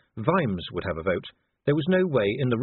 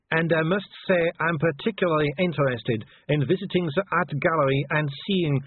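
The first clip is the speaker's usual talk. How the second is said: dazed, higher-pitched diplomat; stunned attaché; 7 semitones higher than usual. A narrator's reading. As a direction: keep up politeness while stunned. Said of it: "in a stunned voice".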